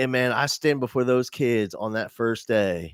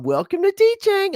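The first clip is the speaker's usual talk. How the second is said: high, loud sing-out greeting; mock-motherly high holler; gleeful mom; 5 dB louder than usual. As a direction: impersonate mom crowing a welcome. Mom greets crying new teacher.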